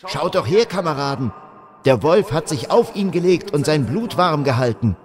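frightened soldier. Acted. Frightened soldier urging comrades.

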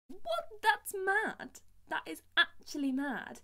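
very high, loud disbelief; incredulous. Real, not acted. Singer outraged at being made to feel inadequate, incredulous. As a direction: burst out in outraged astonishment.

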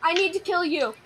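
ordinary talk

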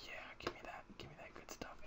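hushed greedy whisper. Pretend. Player whispers greedily over loot, hushed.

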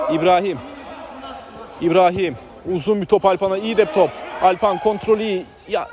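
usual speaking voice

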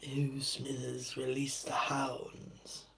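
slow, soft, put-on old villain voice; Mr Burns impression. Burns ordering Smithers to release the hounds, slow and soft. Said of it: "I can't really do it as much".

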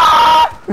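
sudden high-pitched yell; loud high scream. Real, not acted. Player yelling amid the game, high scream. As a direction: scream out at full volume. Yells after shouted apologies.

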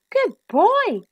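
high-pitched gasp of praise; delighted gasp. A narrator's reading. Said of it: "she gasped".

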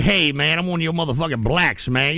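ordinary talk

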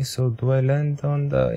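normal talking voice

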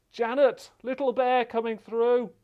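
loud, high call across the water. Real, not acted. Presenter sends Little Bear over to Janet, calling out. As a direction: call it out to someone on another boat.

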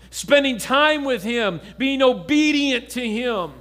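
urging the congregation, steady and firm; preaching exhortation. Acted. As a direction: press each listener to draw closer.

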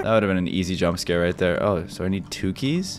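normal talking voice

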